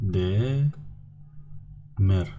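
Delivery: "De" long and rising, pause, "mer"; syllable by syllable. Shown as a demonstration. Teacher gives students the answer, "De, mer" in separate syllables. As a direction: break it into "De" and "mer". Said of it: "I'm going to do it for you".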